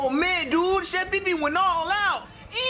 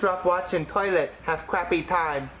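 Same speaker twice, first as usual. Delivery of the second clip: low proverb in a put-on Chinese accent; mock Chinese accent; 10 semitones lower than usual. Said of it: "my name is Doctor Wong".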